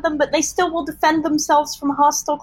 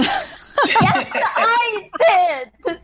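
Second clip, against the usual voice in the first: laughing; 5 semitones higher, much slower than usual. Real, not acted. Proud reply while laughing.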